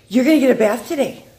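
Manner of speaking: high, loud sing-song to a dog; excited pet talk. Real, not acted. Owner telling Jasmine about bath time, excited pet talk. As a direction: announce it to your pet with exaggerated excitement.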